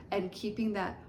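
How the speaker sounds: ordinary talk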